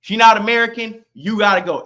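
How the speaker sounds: normal talking voice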